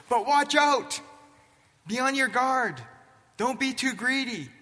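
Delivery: high, loud urgent warning as Jesus; warning shout. Acted. Jesus warning the followers, high loud shout. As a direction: call out the warning to the crowd. Jesus warns the crowd sharply.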